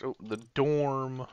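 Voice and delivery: ordinary talk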